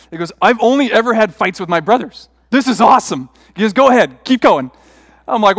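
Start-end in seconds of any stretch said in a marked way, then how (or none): 0.4-3.3 loud, raised-pitch excited retelling; imitating an overjoyed buddy; acted delight. Retold friend delighted by first fight. 3.7-4.7 loud, excited egging-on; imitating a thrilled friend, voice raised; acted eagerness. Delighted friend eggs him on.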